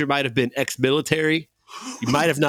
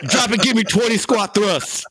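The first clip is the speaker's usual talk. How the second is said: barking gym coach; 7 semitones higher than usual. Pretend. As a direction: bark it like a gym teacher. Host barks gym coach orders.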